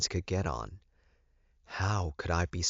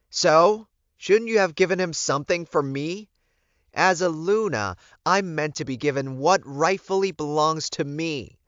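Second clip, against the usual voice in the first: high, loud and indignant; spat out; 11 semitones higher, 9 dB louder than usual. A narrator's reading. Elizabeth spitting her demand at the Alpha. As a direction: spit out the demand like an entitled queen. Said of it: "she spat out".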